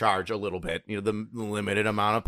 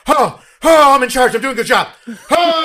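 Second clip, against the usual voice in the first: loud, high gush of mock elation; overjoyed; 13 semitones higher, 12 dB louder than usual. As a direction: burst with hammed-up pride and relief. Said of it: "I never felt so happy".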